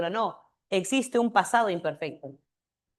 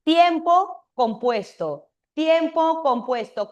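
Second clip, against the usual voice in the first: slow, higher and louder "Tiempo compuesto"; drawn-out, emphatic "Tiempo compuesto"; emphatic teaching; 6 semitones higher, 5 dB louder, slower than usual. Shown as a demonstration. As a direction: spell it out slowly for the class. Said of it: "Acá te lo he puesto, mira".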